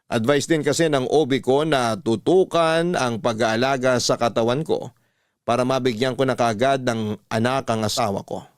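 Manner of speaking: ordinary talk, nothing marked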